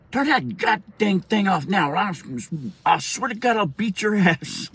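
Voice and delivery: high, slow, furious mother impression; threatening mom. Mother threatening son, retold.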